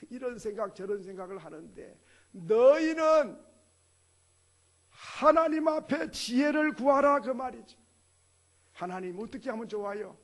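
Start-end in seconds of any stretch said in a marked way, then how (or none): 2.3-7.2 slow, loud proclaiming shout; preaching at full force. Real, not acted. Preacher urging the congregation to seek God, shouted slowly. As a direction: proclaim it like a command from the pulpit.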